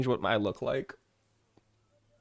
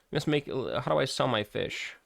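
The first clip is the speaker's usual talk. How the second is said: usual speaking voice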